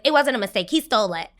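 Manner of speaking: high, forceful contradiction; emphatic accusation. Retold or imitated. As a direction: call him out sharply, as retold. Retold accusation against Alex.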